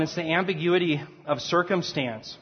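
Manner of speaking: ordinary talk, nothing marked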